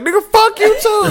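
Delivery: high, laughing "fuck you too"; mock girl's comeback. Imitating a girl snapping back at a rude guy, high and laughing. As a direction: fire it back as a sassy girl. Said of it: "what does the girl say back".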